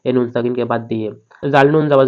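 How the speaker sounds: ordinary talk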